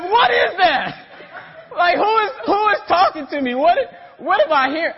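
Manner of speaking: loud, high, comic bafflement; bewildered outburst. Retold or imitated. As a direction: re-enact being freaked out, high and loud. Acts out his bewildered reaction.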